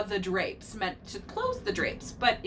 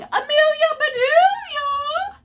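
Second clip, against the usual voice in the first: much higher, louder, long drawn-out call; 9 dB louder, slower than usual. A narrator's reading. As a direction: shout her name across the house. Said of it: "Missus Rogers was calling".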